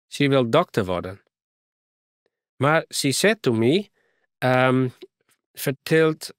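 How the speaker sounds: put-on light English accent in broken Frisian; a foreign girl speaking mixed English and Frisian. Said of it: "Ze praten nogal mooi een accent". (licht Engels accent)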